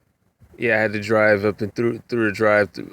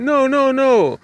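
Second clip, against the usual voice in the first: much higher, louder protest; old lady insisting; 5 dB louder, slower than usual. Retold or imitated. Passenger refusing to drop it, high loud protest. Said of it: "And she was like".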